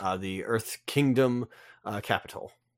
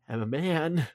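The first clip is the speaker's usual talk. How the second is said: movie quote; 5 semitones higher than usual. Retold or imitated. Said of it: "but it's like".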